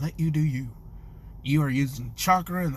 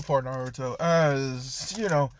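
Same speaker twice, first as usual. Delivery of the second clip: usual speaking voice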